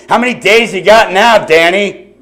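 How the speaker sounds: shouted high and loud across a crowded room; mock yell across room. Shown as a demonstration. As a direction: call it out loud, like he's far away. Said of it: "Don't yell to somebody from across the room".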